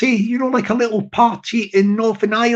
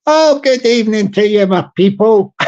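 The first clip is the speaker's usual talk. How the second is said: mock Irish politician greeting a crowd; hearty, hammy Irish brogue; Irish accent; 4 dB louder than usual. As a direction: open a rally speech in put-on Irish. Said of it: "do it with an Irish accent".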